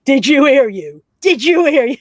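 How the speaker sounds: exaggerated double-take; mock disbelief. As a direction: call someone out, astonished, pitch up. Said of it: "And I was like".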